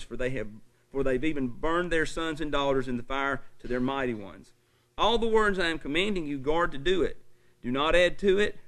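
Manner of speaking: loud, driving pulpit delivery; forceful preaching. Acted. Preacher warning his congregation, loud forceful preaching.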